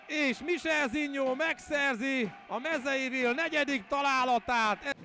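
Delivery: loud high goal scream; excited Hungarian sports commentary. Acted.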